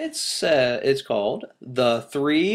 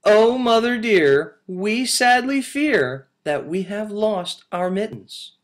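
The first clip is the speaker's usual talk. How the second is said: kitten voice; 8 semitones higher, 5 dB louder than usual. Acted. Kittens confess, second reading.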